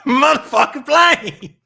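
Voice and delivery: high, full-force furious shout; Samuel L. Jackson. Acted. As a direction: explode into a movie-hero tirade. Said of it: "Do a Samuel L. Jackson".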